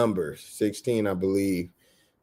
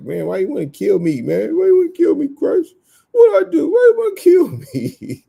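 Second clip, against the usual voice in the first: pitched way up, crying out why you wanna kill me; high whiny pleading; 7 dB louder than usual. Acted. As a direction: beg in a high wailing mock voice. Voicing the wicked pleading with God.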